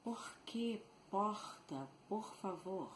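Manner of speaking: "Por que porta, por favor" with guttural French-like R; French-influenced R. Shown as a demonstration. Teacher showing the French court's influence, "porta" with throaty R. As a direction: scrape the R of "porta" at the back of the throat. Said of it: "we pronounce the R by the influence of the French court".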